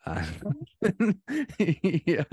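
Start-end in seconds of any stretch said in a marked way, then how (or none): 0.8-2.2 breathy high giggle into a yes. Real, not acted. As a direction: laugh it out helplessly. Giggling after the laces joke.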